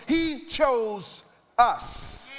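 each word stretched very slow; drawn-out climactic line; slow emphatic reveal. Real, not acted. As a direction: land the punchline slowly. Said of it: "But instead of choosing those things".

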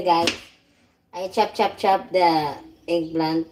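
usual speaking voice

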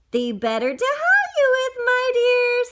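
lightly high sweet fake granny; mock granny voice. Wolf answering the girl in a false sweet granny voice.

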